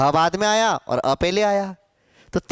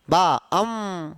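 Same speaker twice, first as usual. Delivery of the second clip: slow syllable split; 4 semitones lower, much slower than usual. Shown as a demonstration. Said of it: "अम, अम है वो।".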